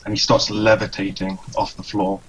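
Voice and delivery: ordinary delivery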